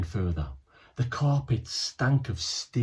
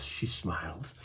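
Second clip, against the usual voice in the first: ordinary delivery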